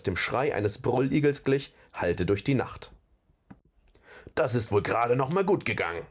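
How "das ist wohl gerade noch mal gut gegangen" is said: ordinary delivery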